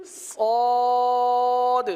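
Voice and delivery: صاد loud and full, long with a thick heavy s; heavy Suad. Shown as a demonstration. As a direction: belt out a heavy, drawn صاد. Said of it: "اس حرف کو پر ادا کیا جاتا ہے". (پر ادا کیا گیا صاد، بلند اور لمبا)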